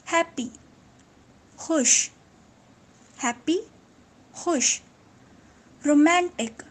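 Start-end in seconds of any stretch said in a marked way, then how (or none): none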